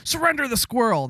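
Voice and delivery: barked command, high and loud; mock raid shout. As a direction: play the officer kicking the door in. Agents raiding for the squirrel.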